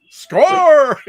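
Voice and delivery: loud high cheer; excited shout. Retold or imitated. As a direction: yell it like you just won. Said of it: "I was like".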